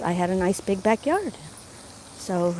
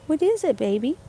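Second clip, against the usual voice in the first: pet talk; 5 semitones higher than usual. Real, not acted. Talking to her dog.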